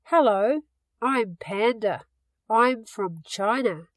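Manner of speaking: ordinary delivery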